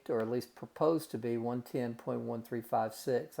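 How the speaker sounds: ordinary talk, nothing marked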